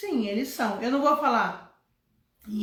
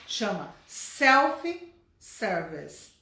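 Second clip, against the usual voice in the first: self-service drawn out slowly, set against the wrong forms; teacher stressing self-service over the wrong forms; slow corrective stress; much slower than usual. Shown as a demonstration. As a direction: correct the students by slowing right down on self-service. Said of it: "não chama self-self".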